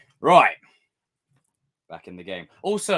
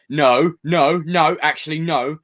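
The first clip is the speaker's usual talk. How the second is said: loud no; 5 semitones higher, 8 dB louder, slower than usual. Real, not acted. Emphatic rejection to chat.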